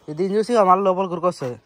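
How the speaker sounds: usual speaking voice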